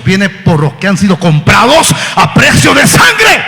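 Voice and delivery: loud, high preacher's shout; preaching at full force. Acted. Preacher warning the church, full-force preaching. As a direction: build to a cry. Sermon build.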